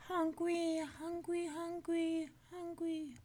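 put-on high little baby whine. A narrator's reading. Finn disguised as baby begging food, high baby voice. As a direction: whine like a hungry infant. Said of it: "The baby began to holler too".

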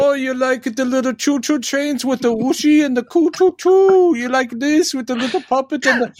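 high, sing-song taunting voice; taunting Toymaker. Retold or imitated. Toymaker taunting the Doctor about toys, high mocking. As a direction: tease like a villain mocking a child. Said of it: "he's like going".

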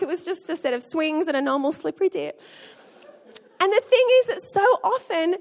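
usual speaking voice

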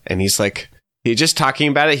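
ordinary talk

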